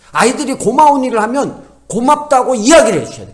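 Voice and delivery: ordinary delivery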